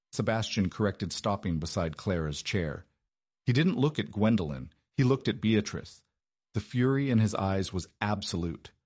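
ordinary delivery, nothing marked

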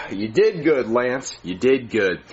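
low gloating villain murmur; sinister low voice. Real, not acted.

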